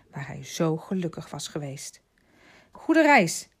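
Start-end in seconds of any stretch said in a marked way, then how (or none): 2.7-3.5 loud, high farewell call; calling after someone. A narrator's reading. Father calling after the departing pair, loud call. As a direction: shout it after them as they leave. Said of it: "riep Barberin nog".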